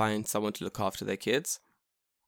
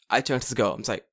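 ordinary talk, nothing marked

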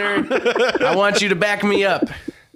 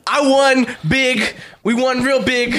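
loud boasting; 5 semitones higher, 6 dB louder, slower than usual. Real, not acted. Protesting losing the contest.

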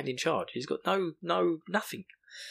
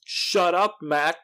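higher, louder exasperated order; mock shout; 5 semitones higher, 5 dB louder, much slower than usual. Host as Perrin silencing Mat, mock shout. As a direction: imitate a friend shouting him down. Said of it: "shouts at Mat and tells him".

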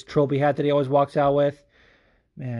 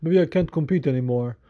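slightly higher imitation of an upset fighter; imitated emotional fighter; 4 semitones higher than usual. Fighter after a loss doubting himself, mildly emotional. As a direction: lightly voice a fighter close to giving up. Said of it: "He gets emotional after a loss".

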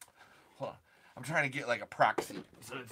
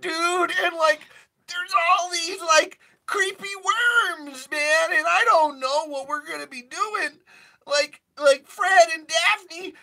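very high, loud stoner voice; cartoon stoner freaking out in the desert; Shaggy stoner voice; 10 dB louder than usual. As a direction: squeak it out like a panicked cartoon stoner. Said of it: "you're just doing the stoner voice".

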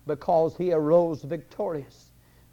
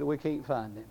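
hushed reply; 8 dB quieter than usual. Acted. Underworld admitting to the devil that he is missing, hushed reply. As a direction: answer much quieter, as if admitting failure.

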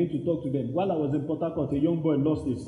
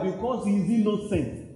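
normal talking voice

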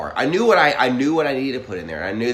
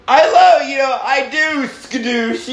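high, loud silly gushing; mock romantic gush; 13 semitones higher, 7 dB louder, much slower than usual. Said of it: "me and you just going".

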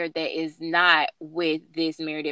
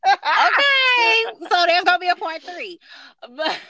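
laughing; 11 semitones higher, 7 dB louder than usual. Real, not acted. Laughing while talking.

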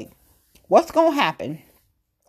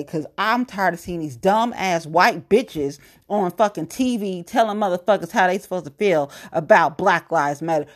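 ordinary talk

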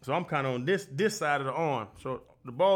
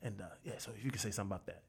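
hushed coach; 6 semitones lower, 9 dB quieter than usual. Retold or imitated. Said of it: "He was like".

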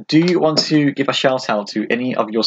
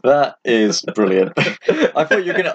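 ordinary delivery

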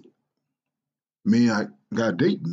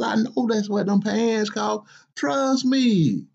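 a grumpy father's higher, exasperated voice; scolding dad; 9 semitones higher than usual. Acted. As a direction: scold your lazy grown son, incredulous. Father mocking son's outfit.

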